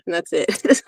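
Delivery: ordinary delivery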